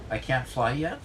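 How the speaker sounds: ordinary delivery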